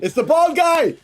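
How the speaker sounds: high, loud, thrilled exclamation; excited recognition. Real, not acted. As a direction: shout it as you spot a favorite. Recognizes the character on screen.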